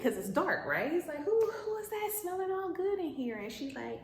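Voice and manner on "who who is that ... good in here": high, playful flirting; flirty Boaz. Acted. Boaz to Ruth in the dark, high playful flirt. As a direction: sniff the air and flirt.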